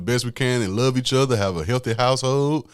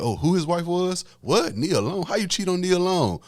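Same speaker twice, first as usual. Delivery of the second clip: high, stunned and incredulous; high mock gasp of disbelief; shocked disbelief; 8 semitones higher than usual. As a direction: act out the crowd's gasping outrage. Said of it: "because they're like".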